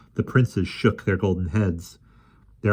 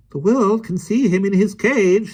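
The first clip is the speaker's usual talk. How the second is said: stubborn; 14 semitones higher, 7 dB louder than usual. A narrator's reading. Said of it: "said Mister Winkle stubbornly".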